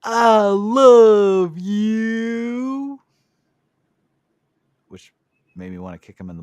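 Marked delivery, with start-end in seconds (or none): 0.0-3.0 very high, drawn-out, louder crooning; Brother Love impression. Podcast host imitating Brother Love's catchphrase, high slow croon. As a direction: stretch it out like a smarmy televangelist. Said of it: "he does this".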